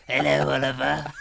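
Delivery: loud put-on old London rogue voice. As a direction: hail the boy in a hammed-up rogue's voice.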